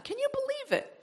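sudden high, soft exclamation; hushed high amazement; amazed aside. Real, not acted. Preacher marvels at Paul's confession.

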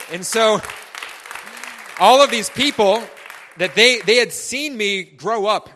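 loud, swelling preacher's shout; preaching at full force. Real, not acted. Pastor rising to a loud shout for the congregation. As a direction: build it up and belt it out to the room.

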